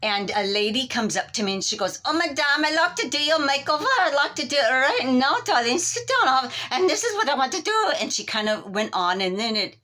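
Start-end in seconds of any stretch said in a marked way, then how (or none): 2.0-7.9 high, fast French stylist voice; French accent. Retold or imitated. French stylist greets the customer in a high accent. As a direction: gush like a French salon lady. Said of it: "starting to talk in French".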